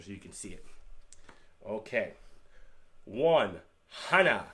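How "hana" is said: "hana" said HAH-nah, loud and clear; Korean pronunciation. Shown as a demonstration. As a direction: model "hana" for students. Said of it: "sounds like hana".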